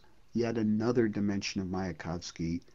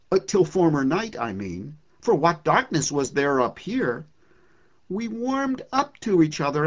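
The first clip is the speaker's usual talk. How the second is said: higher, brighter storytelling voice; poem recitation; 10 semitones higher, 5 dB louder than usual. Acted. Lecturer reading the poem's ending, lively recitation. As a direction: tell the friendship with growing delight.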